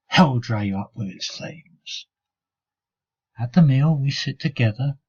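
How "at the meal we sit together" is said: usual speaking voice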